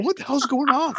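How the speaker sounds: startled, high and fast; mock panic in the car; alarmed. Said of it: "I'm going".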